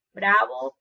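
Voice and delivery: ordinary talk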